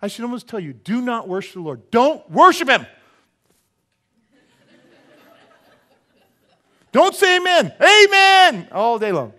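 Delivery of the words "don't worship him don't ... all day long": high, loud ironic call; loud mock command, laughing at the end; ironic shout. Real, not acted. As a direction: shout the reverse to rouse them.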